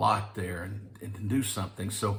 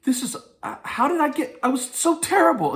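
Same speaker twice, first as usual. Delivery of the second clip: amazed disbelief; 11 semitones higher, 5 dB louder than usual. Retold or imitated. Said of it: "And I'm thinking".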